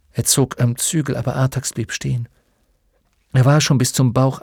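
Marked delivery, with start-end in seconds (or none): none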